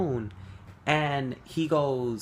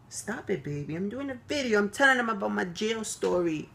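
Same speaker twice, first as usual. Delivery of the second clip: higher, coaxing pet voice; sweet dog talk; 7 semitones higher than usual. Real, not acted. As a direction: gently explain to the dog, high. Coaxing her dog mid-video.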